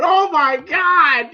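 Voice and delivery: high, slow, drawn-out mock cry; shocked crowd. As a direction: imitate a crowd crying out in shock. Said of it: "the crowd's going".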